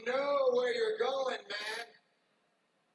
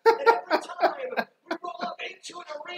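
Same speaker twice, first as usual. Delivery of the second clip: shouting; 6 semitones higher, 8 dB louder, faster than usual. Acted. Shouted promo build.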